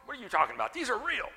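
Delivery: mock indignation, rising high at the end; softly played protest climbing in pitch; mildly indignant. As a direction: imitate an affronted weightlifter without shouting. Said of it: "they get kind of indignant".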